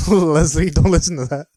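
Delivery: high, loud laughing plea. Real, not acted. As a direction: beg through laughter. Host pleads to absent Leslie.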